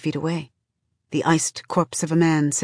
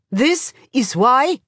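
slow, high shouted retort; shouting down; 7 semitones higher, 3 dB louder, slower than usual. A narrator's reading. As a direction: shout someone down, stretching each word. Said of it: "Someone else shouts him down".

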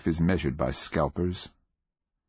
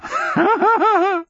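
high, loud rapid laugh; high old-man ha-ha-ha; mad old cackle; 7 dB louder than usual. A narrator's reading. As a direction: burst into a crazed cackle. Sergeant laughs madly.

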